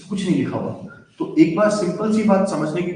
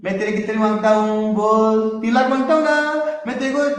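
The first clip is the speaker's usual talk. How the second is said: higher, insistent lender talking a friend round, rushing the last line; Monu, scheming debtor; 6 semitones higher than usual. Acted. Teacher acting Monu coaxing Kajal about the loan, high and pushy. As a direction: play Monu pressing Kajal over money, then slyly fast. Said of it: "तो मोनू ने बोला".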